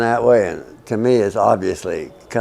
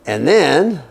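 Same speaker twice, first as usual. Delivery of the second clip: usual speaking voice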